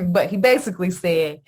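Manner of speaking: ordinary delivery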